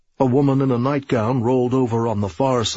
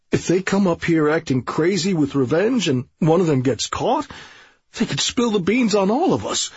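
higher, rising, frantic corporal; panicking corporal; 6 semitones higher than usual. Acted. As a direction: spill out the fear.

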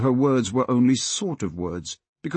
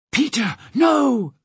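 high, drawn-out pleading cry; shout; 13 semitones higher, slower than usual. Acted. Molly begs Peter not to bargain, high shout. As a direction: plead urgently, stretching the words. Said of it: "shouted Molly".

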